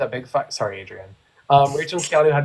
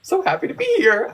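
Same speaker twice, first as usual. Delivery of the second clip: much higher, mock cheerful; imitating a giddy housemate; mock chipper. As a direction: squeal it like a suddenly delighted person. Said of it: "she came back out being like".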